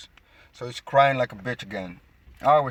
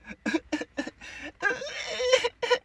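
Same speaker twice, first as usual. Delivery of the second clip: mocking laugh. Real, not acted. Mocking Esau's crying.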